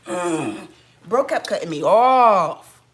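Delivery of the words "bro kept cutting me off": loud and slow, outraged; indignant. Real, not acted. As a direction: lean on it, fed up and loud. Indignant retelling, much louder.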